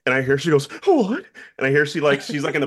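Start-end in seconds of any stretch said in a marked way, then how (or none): 0.8-1.2 very high, louder stunned What?; shocked mom. Retold or imitated. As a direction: gasp it out in disbelief. Mom hears news in parking lot.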